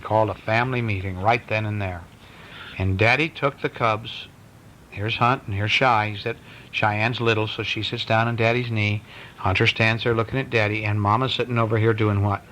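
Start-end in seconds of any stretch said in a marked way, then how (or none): none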